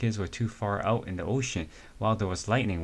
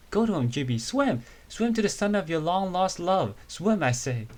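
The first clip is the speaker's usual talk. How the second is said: much higher, hammed-up theatrical cheer to swim; dramatic urging; 9 semitones higher than usual. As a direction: urge him on like a soap-opera heroine. Friend urging him on dramatically.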